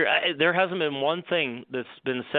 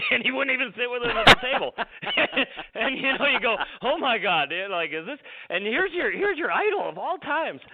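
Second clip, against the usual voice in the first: high, giggly laughter through the words; laughing while talking; 12 semitones higher than usual. Real, not acted. Laughing retelling the Hogan story.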